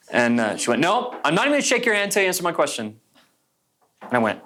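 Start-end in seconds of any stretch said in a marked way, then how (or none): 0.8-3.0 high-pitched, fast mock refusal; indignant refusal. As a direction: refuse firmly in a quick, raised voice. Acted refusal to a stranger.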